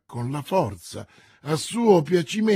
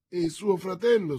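plain reading voice

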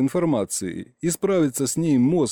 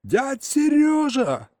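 very high, drawn-out astonished yell; 13 semitones higher, slower than usual. A narrator's reading. Boy yelling to newly found uncle, astonished. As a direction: scream the name high, stretching it out. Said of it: "заорал я, выпучив глаза".

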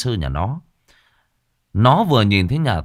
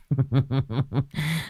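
mocking giggle; 4 semitones higher, 5 dB quieter than usual. Effeminate man mocking the shaman.

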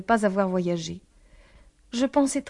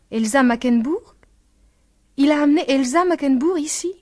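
loud, higher, incredulous questioning; shocked disbelief; 5 semitones higher, 6 dB louder than usual. A narrator's reading. Anne learning that Elsa has been brought here, shocked disbelief. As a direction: build from a stunned question to an outraged one.

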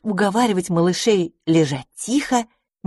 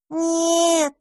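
stubborn; 11 semitones higher than usual. Acted. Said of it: "заупрямился дракончик".

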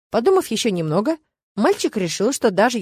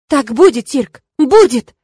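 loud, drawn-out furious insistence; heated, emphatic outburst; angry hiss; 4 semitones higher, 10 dB louder, slower than usual. A narrator's reading. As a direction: hammer it at him angrily. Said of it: "зашипела на него мама".

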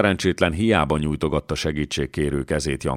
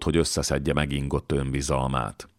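ordinary delivery

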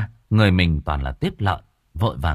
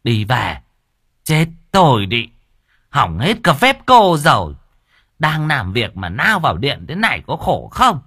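louder, higher exasperated rebuke; angry scolding; 5 semitones higher, 6 dB louder than usual. A narrator's reading. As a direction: chase her out, fed up. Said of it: "chỉ tay ra ngoài nói".

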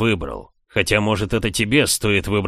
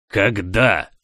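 growled question; 4 dB louder than usual. A narrator's reading. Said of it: "рыкнул Диан".

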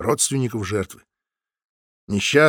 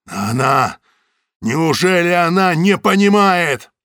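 slow, loud, high despairing cry; anguished cry; 5 semitones higher, 6 dB louder, slower than usual. A narrator's reading. Samoylov despairs about the victim, loud anguished. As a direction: cry out in disbelief.

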